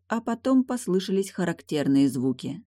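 usual speaking voice

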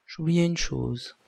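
ordinary delivery

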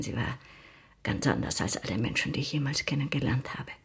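lost in memory, barely audible; hushed reverie. Acted. Lizzie recalls lost beloved friend.

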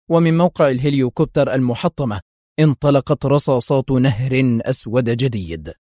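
usual speaking voice

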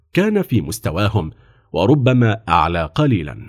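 usual speaking voice

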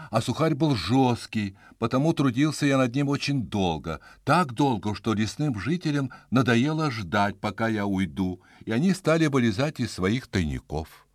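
ordinary delivery, nothing marked

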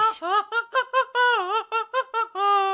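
shrill villain laugh; high mocking cackle. Draco laughing at the fat players, high mocking cackle. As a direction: cackle down at them.

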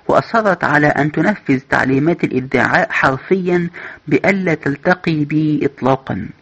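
ordinary delivery, nothing marked

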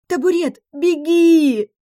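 high, loud desperate scream; frantic scream. A narrator's reading. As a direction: yell for the pet to run. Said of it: "заорала истошно".

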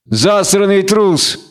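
slow, high-pitched insult; drawn-out sneer at a coward; contemptuous snort. A narrator's reading. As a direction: spit it out with scorn. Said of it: "фыркнул Таврик".

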